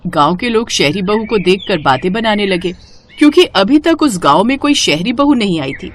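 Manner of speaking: ordinary delivery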